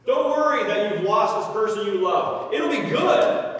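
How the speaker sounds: oblivious, booming consolation; insensitive reassurance. Acted. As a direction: push cheer onto the grieving. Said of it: "How insensitive to imply".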